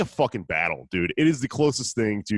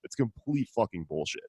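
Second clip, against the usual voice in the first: ordinary delivery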